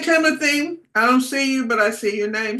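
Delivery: ordinary talk, nothing marked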